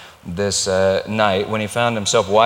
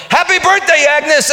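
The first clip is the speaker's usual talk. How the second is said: high, loud party shout; screamed greeting; 7 dB louder than usual. Retold or imitated. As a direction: yell it like a surprise crowd. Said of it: "they all screamed".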